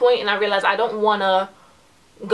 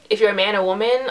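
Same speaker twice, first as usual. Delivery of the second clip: ordinary delivery, nothing marked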